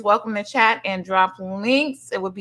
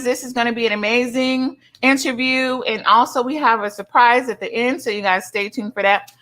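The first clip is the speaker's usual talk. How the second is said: usual speaking voice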